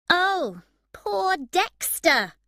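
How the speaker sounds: high, loud, slow mocking pity; angry sarcasm. A narrator's reading. Emma mocking Dexter's self-pity, angry and sarcastic. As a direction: sneer the sympathy at him. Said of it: "Emma said angrily".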